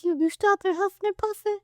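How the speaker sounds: mock the wolf in a high pinched voice; incredulous mockery. Pig mocking wolf outside.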